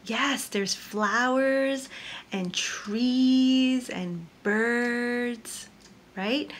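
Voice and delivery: soft slow listing for the little ones; slow soft teacher voice; gentle. Real, not acted. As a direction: coax them along gently. Gentle recap to children.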